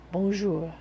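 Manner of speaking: hammed-up French accent on bonjour, said bon-ZHOOR; a jokey, clumsy French bonjour; bad French accent. To a French viewer, greeting in a mock French accent. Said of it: "in a horrible accent".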